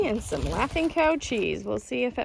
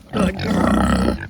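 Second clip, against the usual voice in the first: ordinary delivery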